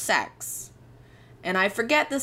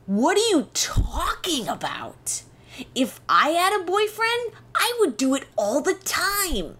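put-on high, earnest kid voice, pitched well up; naive teen self; 9 semitones higher than usual. Telling health class girls she'd do it constantly, high and earnest. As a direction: play your clueless thirteen-year-old self, wide-eyed.

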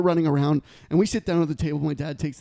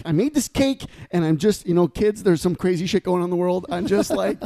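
louder, flustered mom rant; 4 dB louder than usual. Retold or imitated. Upset mom explaining to party kids, flustered. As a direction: struggle to explain while coming apart. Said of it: "she was like".

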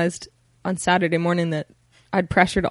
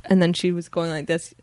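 ordinary talk

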